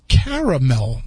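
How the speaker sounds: "caramel" drawn out in three syllables, CARE-uh-mel; his own caramel. Shown as a demonstration. Host insisting on his way, "caramel" slow as CARE-uh-mel. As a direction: slowly give "caramel" all its syllables. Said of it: "That's what I say".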